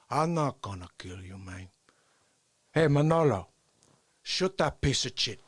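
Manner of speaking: low Cuban gangster, then a harder order; Tony Montana. Acted. Scarface telling Manolo to shoot, Tony Montana impression. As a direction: coolly order a killing in gangster dialect. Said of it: "walk around as Tony Montana".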